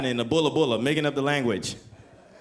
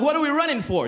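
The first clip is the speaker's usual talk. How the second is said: pitched way up, puzzled; baffled friend asking why they run; high puzzled cry; confused, alarmed; 8 semitones higher, 3 dB louder, faster than usual. Retold or imitated. Retold question while fleeing cops.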